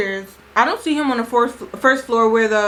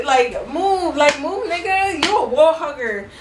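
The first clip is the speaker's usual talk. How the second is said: mock taunt; 5 semitones higher than usual. Narrator taunting shy guys.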